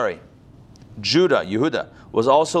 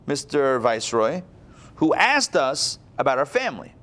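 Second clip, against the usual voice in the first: normal talking voice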